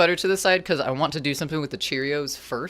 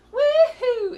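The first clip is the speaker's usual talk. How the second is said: cheering whoop; 7 dB louder than usual. Real, not acted. Cheering at the song.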